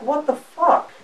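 incredulous, pitched sharply up; retold disbelief. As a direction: act out being utterly baffled by her. Retelling his reaction to her screaming.

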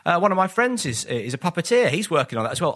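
high, bright, cheerful chatter; chirpy clueless reply. Retold or imitated. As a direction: gush innocently, no idea who he is.

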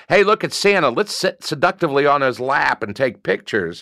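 put-on high-pitched gum-smacking mall girl; put-on girly voice, pitched well up; Jersey mall rat girl. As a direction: imitate an eager Jersey mall rat calling her friends. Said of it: "were Jersey mall rat girls".

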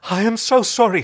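high, loud, emphatic plea; anguished apology. Acted. Harvey falls at Scorpius's feet, anguished apology.

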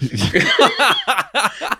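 high giggling laugh; high burst of laughter. Real, not acted. Laughing at offensive joke.

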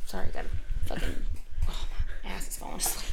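quick, hushed aside under her breath; whispered aside. Real, not acted. To the co-host, shifting in her seat, a quick whispered aside. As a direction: mutter it fast, half to herself.

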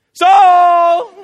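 loud, high call across the valley; shouting across a valley. Retold or imitated. David calls to Saul across the valley, shouting. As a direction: yell his name to carry far. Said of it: "And he's like".

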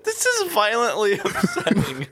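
high, slow words squeezed through laughter; real laughter breaking the words; helpless laughing. Real, not acted. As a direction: try to speak while cracking up. Co-host cracking up at the bit.

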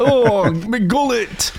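a lightly put-on Yorkshire "my gullet"; joking Yorkshire accent for a sore gullet; mock Yorkshire moan.

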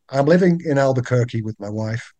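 quiet, low attempt at an American accent; British speaker trying to pass as American. Shown as a demonstration. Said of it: "Say that in, like, American".

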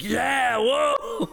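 high, whooping party crowd; mock crowd cheer. Crowd at a Red Bull event cheering the DJ, mock whoop. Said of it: "everyone's like".

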